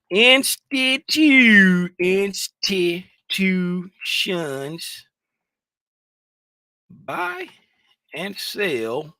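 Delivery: very high, drawn-out announcer wail; dramatic stretch. Pretend. Trader hams up the title for viewers, high and drawn out. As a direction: stretch each syllable for show.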